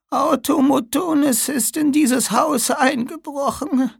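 high, slow reedy falsetto. Acted. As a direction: squeeze it out in falsetto. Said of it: "fistelte die alte Frau".

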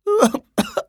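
high, wet dying cough; weak cough. Acted. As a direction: cough up blood. Wounded woman coughs. (schwaches Husten)

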